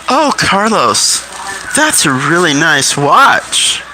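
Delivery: hammed-up flirty lady, teasing; femininely appealing voice. Coaching the nurse to tease a blind patient, femininely appealing. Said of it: "femininely appealing voice".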